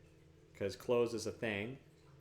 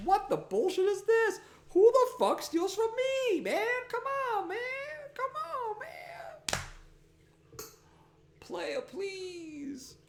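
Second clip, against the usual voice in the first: very high squeaky outrage, fading to breath; high indignant squeal; slower than usual. Pretend. Complaining about the thief, very high squeaky outrage. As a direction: squeak in mock fury.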